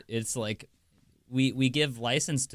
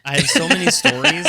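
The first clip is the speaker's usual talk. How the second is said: high, loud laughing insistence; laughing protest; 9 dB louder than usual. Real, not acted. The host defends his stories to Biscuits, laughing high and loud.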